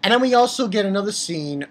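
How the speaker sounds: ordinary talk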